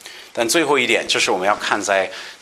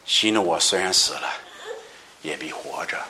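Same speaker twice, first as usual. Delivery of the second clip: low, soft and solemn; a solemn Jesus, lowered and softer; Jesus speaking; 4 semitones lower, 4 dB quieter than usual. Acted. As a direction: speak it gravely and gently. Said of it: "耶稣对他说".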